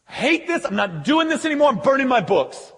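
high, loud vow to quit; a convert swearing off the old life; fervent repenting convert. Acted. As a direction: renounce it all with fire. Ephesian converts renouncing magic.

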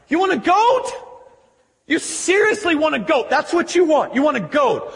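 high, disbelieving questioning; incredulous. Acted. Father answering older son's goat complaint, incredulous. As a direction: throw it back at him in high-pitched disbelief.

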